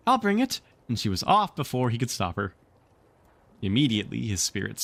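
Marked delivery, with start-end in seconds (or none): 0.1-0.6 put-on high, eager child's voice; eager little girl. Wendy offers to fetch the medicine, high and eager. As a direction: chirp it, keen to help. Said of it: "always glad to be of service".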